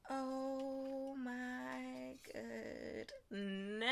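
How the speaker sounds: soft, drawn-out awed murmur; very slow hushed amazement; hushed awe. Real, not acted. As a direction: breathe it out slowly in wonder. Unboxing awe.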